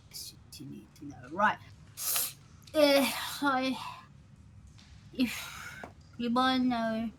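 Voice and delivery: a hint of something stuck in the mouth; mouth-full character voice. Bar regular defending a missing boss, halting and mouth-full. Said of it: "Did you stick something in your mouth to, to speak like this?".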